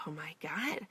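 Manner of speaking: breathy unvoiced oh my God; a stunned hushed gasp of words; shocked whisper. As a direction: whisper it in disbelief. Inner reaction to creepy sign.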